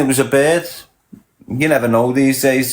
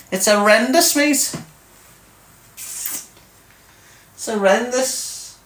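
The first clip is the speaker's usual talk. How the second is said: exaggerated disgust; 9 semitones higher than usual. Real, not acted. Warning young lads off, high and horrified. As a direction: pull a disgusted face through the word horrendous.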